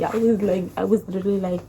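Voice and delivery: ordinary talk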